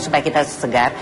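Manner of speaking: ordinary talk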